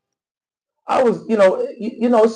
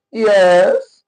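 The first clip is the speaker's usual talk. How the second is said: a short, louder "Yes." in a put-on Somali accent; mocking Somali-accented yes; 3 semitones higher, 5 dB louder than usual. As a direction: poke fun by faking a Somali accent. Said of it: "That accent".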